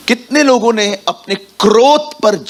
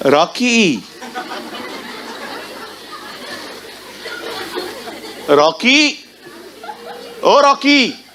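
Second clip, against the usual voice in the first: put-on calling the child's name ever higher; mother calling, rising; 7 semitones higher, 4 dB quieter, much slower than usual. Preacher's mother calls Rocky from the kitchen, rising. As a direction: call Rocky, climbing in pitch each time.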